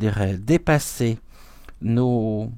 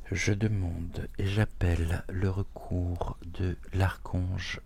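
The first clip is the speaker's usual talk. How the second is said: quiet prayer; 4 semitones lower, 9 dB quieter than usual. Real, not acted. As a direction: murmur a prayer to the archangel. Protection prayer before reading.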